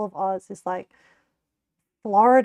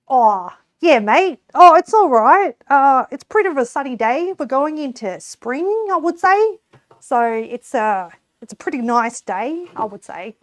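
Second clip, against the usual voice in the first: hammed-up country Australian accent, louder and higher; broad Aussie accent; 4 semitones higher, 7 dB louder than usual. Shown as a demonstration. Streamer answers chatter in a put-on Australian accent. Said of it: "to make it really more Australian".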